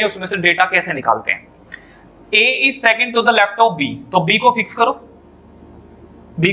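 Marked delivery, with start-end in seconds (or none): 2.3-4.1 slow dictation. Shown as a demonstration. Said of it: "teacher reads data slowly".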